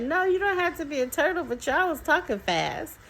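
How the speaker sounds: high-pitched imitation of a little girl; child's reply. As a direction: answer quickly in a high kid voice. Retelling child's answer.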